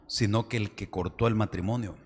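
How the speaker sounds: usual speaking voice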